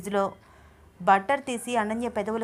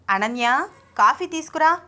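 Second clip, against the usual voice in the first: raised, louder call with mock authority; showy ordering; 5 semitones higher, 5 dB louder than usual. A narrator's reading. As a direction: order it grandly for show. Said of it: "order వేస్తున్నట్టు buildup ఇస్తున్నాడు".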